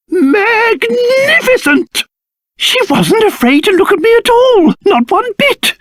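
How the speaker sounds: high, gleeful exclamation; theatrical high-pitched delight. Acted. Delighted the girl was unafraid.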